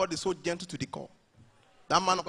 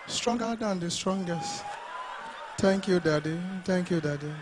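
gentle pastor worship; 4 dB quieter than usual. Retold or imitated. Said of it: "this is what you begin to hear".

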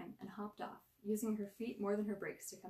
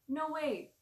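defensive; 8 semitones higher, 9 dB louder than usual. A narrator's reading. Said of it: "returned defensively".

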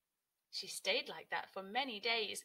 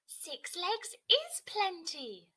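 higher, louder, slow and pleased; Cody cheerfully landing beside the grasshopper; delighted; 8 semitones higher, 8 dB louder, much slower than usual. Acted. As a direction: draw it out gladly.

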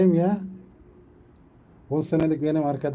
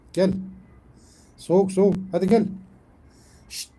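calling the cat; 6 semitones higher, 5 dB louder than usual. Real, not acted.

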